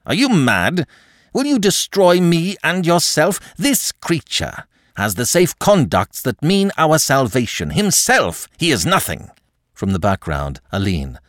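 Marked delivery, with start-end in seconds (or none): 0.1-9.3 raised, furious protest; angry. A narrator's reading. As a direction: snap at her in fury. Marquis struggles free of her.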